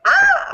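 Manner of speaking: a sharp, very high, loud "Ah!" scream; retold scream. Hearing her name on the call, a high squeal. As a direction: let out a startled squeal of joy. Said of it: "like, I screamed".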